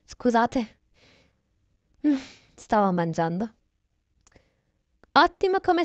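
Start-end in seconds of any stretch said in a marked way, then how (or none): none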